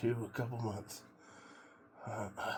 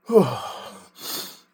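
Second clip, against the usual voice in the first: loud falling exhale into cold water; sharp sigh; 7 semitones higher, 15 dB louder than usual. Real, not acted. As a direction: breathe out hard against the cold. Entering cold plunge.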